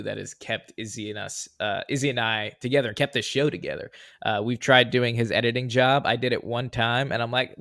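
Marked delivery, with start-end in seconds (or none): none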